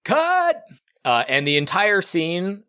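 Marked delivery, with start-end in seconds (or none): 0.0-0.6 sudden high-pitched shout; one sharp, high yell; shouted command. Acted. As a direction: call "cut" like a director halting a shoot. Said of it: "and then he shouts".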